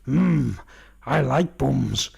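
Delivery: low, slow, leering drawl; slow leering growl. Retold or imitated. Mango leering at the banana in the cell, slow and low. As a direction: ham up a lecherous creep. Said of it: "Mango's going".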